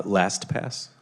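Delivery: LastPass said slowly and distinctly; slowed-down repeat. Real, not acted. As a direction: repeat LastPass slowly for someone who missed it. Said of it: "you say it really fast".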